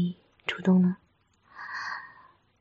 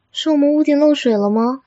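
louder, higher mock puzzlement; playful teasing; 5 semitones higher, 6 dB louder, faster than usual. Girlfriend teasing partner who denies crying, mock puzzlement. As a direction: pretend to wonder in earnest.

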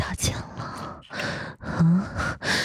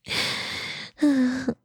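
breathy giggle; 4 semitones higher, 4 dB quieter than usual. Acted. Giggle in the ear.

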